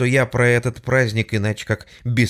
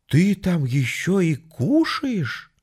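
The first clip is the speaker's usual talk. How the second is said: slow, higher scared ask; timid question; 7 semitones higher, slower than usual. Acted. Frightened woman on phone. (робко, испуганно)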